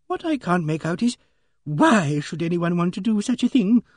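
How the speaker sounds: high, plaintive lady's voice; puzzled Emily Barton. A narrator's reading. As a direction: ask in pained bewilderment, pitched high.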